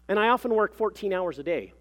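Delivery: high, loud, self-important claim; pompous professional. A narrator's reading. Mother describes her research to the clerk, pompous and assured. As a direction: proclaim it like an impressive credential.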